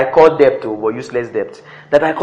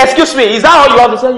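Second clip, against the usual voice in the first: imitating an objector, high and loud; mock challenge; 9 semitones higher, 7 dB louder, faster than usual. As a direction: act out a loud, high interruption. Acted challenge from listeners.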